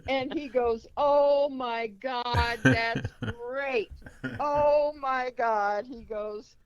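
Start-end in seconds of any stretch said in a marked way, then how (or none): 1.0-5.8 slow, drawn-out, higher exclamation; hammed-up thrilled reaction; imitated delighted astonishment. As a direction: stretch each exclamation in mock amazement. Frank's delighted reaction is acted out.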